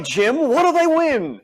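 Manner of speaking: booming slow announcer call; game show announcer. Pretend. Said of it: "you have to say this like a game show announcer".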